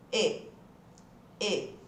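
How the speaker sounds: E as a closed, low-pitched ê. Shown as a demonstration. Teacher has students repeat the sound, low closed ê. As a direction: say "E" closed, as with a circumflex. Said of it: "aquele E com acento circunflexo".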